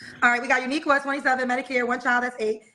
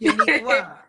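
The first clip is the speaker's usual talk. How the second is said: mock mama naming her; 7 semitones lower, 5 dB louder, slower than usual.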